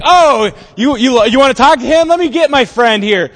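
loud, high, hammed-up eagerness; exaggerated two-faced helpfulness; mock over-friendly. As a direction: suddenly gush like his best friend. Rebukers suddenly acting friendly to him.

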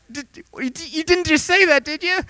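very high, stammering squeak; hammed-up nervous disbelief; scared bystander, acted. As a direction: stammer it in a terrified falsetto. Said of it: "pissing your pants".

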